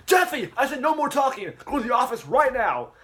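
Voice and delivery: high, loud furious shout; teacher yelling at a student to go to the office; angry yell. Retold or imitated. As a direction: bellow it at the kid. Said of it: "all the yelling".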